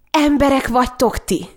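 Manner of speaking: high outraged cry; cry of outrage. A narrator's reading. Sister cries out at her cruel family in outrage. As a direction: fling the accusation at them.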